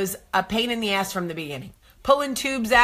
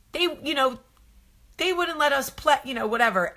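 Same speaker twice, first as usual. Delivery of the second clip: put-on higher, sheepish kid voice; little boy excuse; 6 semitones higher than usual. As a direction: mumble an excuse like a caught kid.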